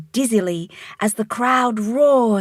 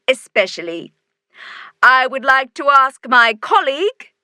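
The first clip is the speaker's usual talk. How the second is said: raised, high voice for a crowd; loud speech; 4 semitones higher, 6 dB louder than usual. A narrator's reading. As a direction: address a roaring crowd. Mayor addressing cheering crowd.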